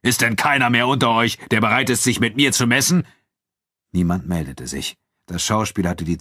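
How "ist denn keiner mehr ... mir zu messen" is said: raised, higher challenge to a crowd; Abu Dun calling out. A narrator's reading. Nubian fighter challenges the spectators, calling out. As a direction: call down from the stage, daring them. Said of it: "rief Abu Dun von der Bühne herab".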